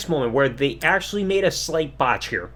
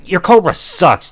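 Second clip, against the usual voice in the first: slow and louder scolding; loud slow dismissal; 7 dB louder than usual. Real, not acted. Reviewer telling off Santino, loud and slow. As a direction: lean into it, telling him off.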